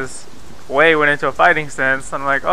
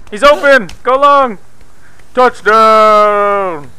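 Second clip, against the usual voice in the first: loud, high hammed-up sportscaster calls; mock football call; 6 semitones higher, 3 dB louder, much slower than usual. Player shouting football calls while tossing a stolen purse. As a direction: cheer it like a touchdown play.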